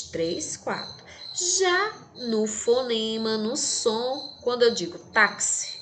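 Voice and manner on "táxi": "táxi" with X sounded as K-S. Shown as a demonstration. Teacher showing the phonemes in "táxi", X as KS. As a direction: say "táxi" with a clear ks. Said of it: "esse X tem som de quê?".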